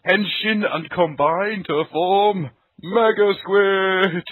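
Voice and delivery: dramatic announcer voice. Pretend. Announcing giant robot combination.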